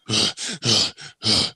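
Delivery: exaggerated angry huffs and puffs; mean huffing. Imitating the angry father being calmed by his wife, huffing. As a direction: imitate someone puffing crossly. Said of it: "huffing and puffing all meanly".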